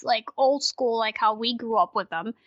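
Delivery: ordinary talk, nothing marked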